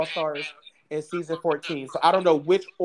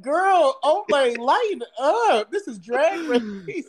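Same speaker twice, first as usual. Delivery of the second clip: high, slow exasperated drag sass; sassy scolding; 8 semitones higher, slower than usual. Retold or imitated. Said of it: "I'm like".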